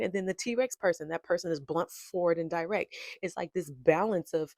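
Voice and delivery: usual speaking voice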